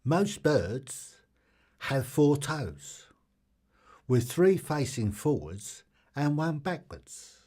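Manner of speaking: loud, projected fact reading; fact announcer. Real, not acted. Quiz host reading trivia to the audience, loud announcer delivery. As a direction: announce each phrase loudly, with clear pauses.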